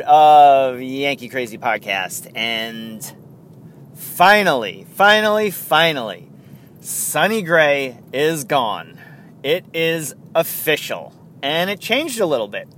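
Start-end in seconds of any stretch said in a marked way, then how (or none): none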